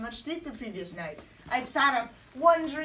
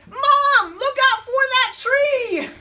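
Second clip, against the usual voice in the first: very high, very loud warning shout; yell; 13 semitones higher, 10 dB louder, slower than usual. Acted. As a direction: scream a desperate warning to Mom. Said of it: "And then I yelled".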